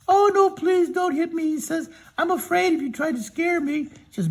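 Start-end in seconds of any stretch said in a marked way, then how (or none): 0.1-1.5 high, loud pleading lion; storyteller voicing a high, timid cowardly lion. 2.2-3.9 storyteller voicing a high, timid cowardly lion.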